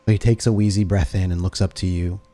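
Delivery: ordinary talk, nothing marked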